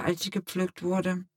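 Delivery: plain reading voice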